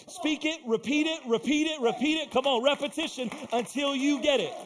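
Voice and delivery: loud, high, building preacher's cry; full-force preaching. Acted. Preacher rousing the congregation about second chances, full-force shouting.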